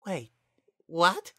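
high, sudden startled cry; shocked. Acted. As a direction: caught completely off guard. Flowey shocked at being spared.

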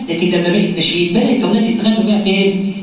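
ordinary delivery

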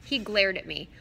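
plain reading voice

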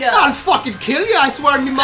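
a hammed-up Irish brawler; mock Irish accent, shouting. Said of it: "Isn't that what they sound like?".

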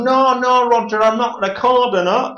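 high, loud mock northern accent; weird northern accent. Chris lying to Roger, high mock northern accent. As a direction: ham up a northern bloke's denial. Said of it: "he's got this really fucking weird northern accent".

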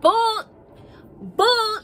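sharp rising "bull", said twice; loud scoff. Acted. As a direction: call out the lie loudly. Calling out a lie loudly.

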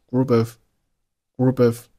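"group of" joined, p running into the vowel; linked words. Shown as a demonstration. Teacher demonstrating linking for learners, linked "group of". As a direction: carry the p of "group" over into "of". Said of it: "group和of就连读成了".

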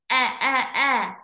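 three short separate "eh" sounds; isolated short E. Shown as a demonstration. Teacher drilling the short E, isolated sound. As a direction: repeat "eh" crisply, each one apart. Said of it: "Short E".

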